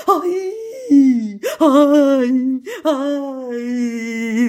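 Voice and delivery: high, loud sobbing ays; wailing cries. A narrator's reading. Family wailing in the cellar, high sobbing cries. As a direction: cry out in grief. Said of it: "decían y lloraban".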